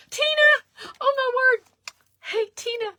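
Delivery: high squealing hello to a friend; excited greeting. Real, not acted. Vlogger greeting her friend Tina joining live, squealing with joy. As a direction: shriek her name with delight.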